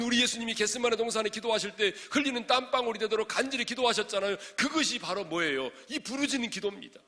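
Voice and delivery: loud, high, driving pulpit delivery; full-force preaching. Real, not acted. Preacher urging crying-out prayer with passion, full-force preaching. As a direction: proclaim each sentence at the top of the range.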